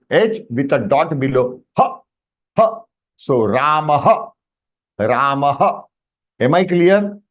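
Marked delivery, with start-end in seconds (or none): 1.8-2.8 "ha" as a very short puff of breath; half H. Shown as a demonstration. Teacher showing visarga, a short isolated "ha". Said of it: "having half H". 3.4-5.8 "Ramah" slowly, ending in short rising "ha"; visarga ending. Shown as a demonstration. Teacher demonstrating to the class, "Ramah" as "Raa-ma-ha". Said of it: "is the pronunciation in Sanskritam".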